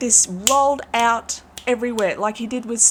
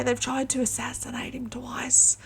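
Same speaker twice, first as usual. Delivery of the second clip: whisper; 3 semitones higher, 5 dB quieter than usual. Real, not acted. Confiding a secret about Trump.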